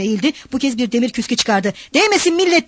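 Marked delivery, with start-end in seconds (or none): 1.9-2.7 high-pitched call to clear the way; raised, urgent cry; warning shout. A narrator's reading. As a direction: call out to the crowd to stand back. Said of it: "diye bağırıp".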